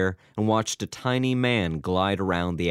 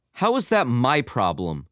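higher, sharp irritated retort; barked retort; 5 semitones higher, 4 dB louder than usual. A narrator's reading. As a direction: bark it back at him, annoyed. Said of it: "the receptionist barked".

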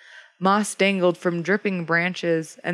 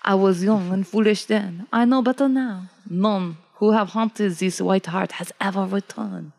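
put-on French-accented storyteller; world-weary warning with a French accent. Old hunter warns Jacob off, French accent. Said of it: "The Frenchie says".

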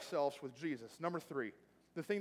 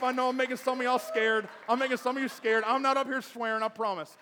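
raised, higher-pitched pulpit voice; full-force preaching; 5 semitones higher, 7 dB louder than usual. Real, not acted. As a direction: drive it at the congregation, loud and urgent.

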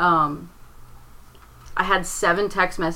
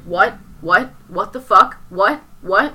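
louder, pitch climbing on each what; shocked father, can't take it in; stunned repeated what; 2 semitones higher, 5 dB louder than usual. Retold or imitated. Father woken with the news, repeating what in shock. Father hears mother died.

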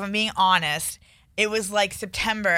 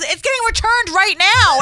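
high, loud exasperated yell; angry shout; 12 semitones higher, 6 dB louder than usual. Retold or imitated. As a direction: yell it in frustration. Boss yells about returned car.

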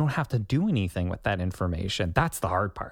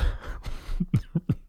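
real laugh; 9 semitones higher than usual. Real, not acted. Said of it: "laughter after porridge joke".

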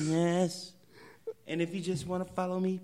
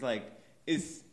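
usual speaking voice

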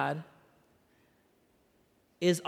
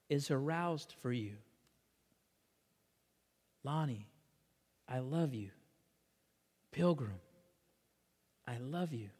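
tender; 6 dB quieter than usual. Acted. Preacher voicing God's love to each listener, hushed and tender. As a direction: let each phrase land gently, with space. God says I love you.

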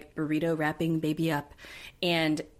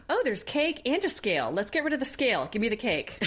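high, gleeful mock mom voice; mom behind the door; 6 semitones higher than usual. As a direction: imitate a giddy mom delighted by cake.

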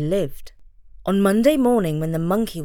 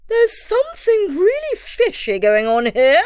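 high, loud bird voice; very high heron voice; 11 semitones higher, 4 dB louder than usual. Acted. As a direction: squawk it like a heron. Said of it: "the heron said".

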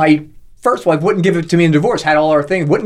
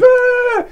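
put-on high squealing wail as a little kid; mock child wail; 3 dB louder than usual. Child wailing in his room.

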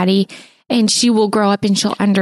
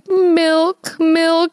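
higher, little-girl mock plea for milk; imitating sleepy toddler; 7 semitones higher than usual. Mom retelling her toddler's night call, lightly imitated toddler voice. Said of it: "she was like".

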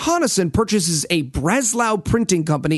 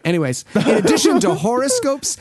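ordinary talk

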